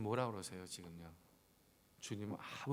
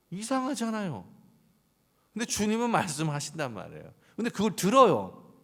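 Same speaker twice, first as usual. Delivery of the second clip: higher, louder, slowed-down preaching; a higher, louder preacher building force; fervent preaching; 8 semitones higher, 10 dB louder than usual. Real, not acted. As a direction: press the point with rising fervor.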